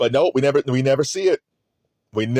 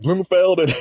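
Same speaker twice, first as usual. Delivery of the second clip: usual speaking voice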